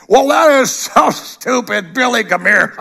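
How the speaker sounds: high, slow sneer of a scoffer; mocking scoffer. Preacher imitating a scoffer, high slow sneer. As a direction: scoff at the believer, then call Billy.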